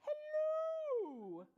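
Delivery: high, loud, drawn-out hello; a silly surprise voice from the puppy; comic hello. Acted. Vet's voice from inside puppy.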